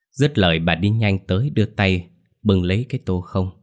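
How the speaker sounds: ordinary delivery, nothing marked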